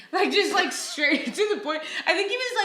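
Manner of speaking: high, laughing through the words. Real, not acted. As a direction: crack up at your own boldness. Laughing at own bold text.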